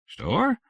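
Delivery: Store? with a sharp high rise; suspicious rising store. Acted. Grocer answering the phone, a suspicious rising Store?. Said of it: "with the rising inflection that seemed to indicate suspicion".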